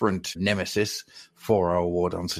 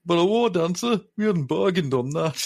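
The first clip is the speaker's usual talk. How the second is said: mocking enemy voice rising into alarm; gloating warband leader; 6 semitones higher than usual. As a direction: taunt the lone elf, then falter as it sinks in. Reads out the enemy warband's lines.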